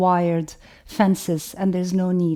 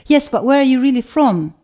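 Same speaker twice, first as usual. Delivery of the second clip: higher, louder voice of a pressing questioner; insistent; 5 semitones higher, 7 dB louder than usual. Acted. Questioner unsatisfied by her answer about origins, insistent. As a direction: push the question, mock insistence. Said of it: "they would insist".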